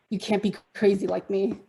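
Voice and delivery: ordinary talk, nothing marked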